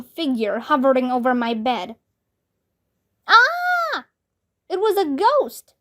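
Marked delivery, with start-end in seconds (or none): 3.3-5.7 high frightened cry; scared. A narrator's reading. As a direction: yelp in fright at a ghost. Boy sees ghost.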